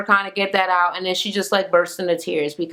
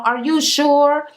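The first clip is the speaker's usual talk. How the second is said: drawn-out, higher "are you SURE"; mimicking mother; 7 semitones higher, slower than usual. Daughter quoting mother's question back at her, mocking. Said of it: "Because you're like".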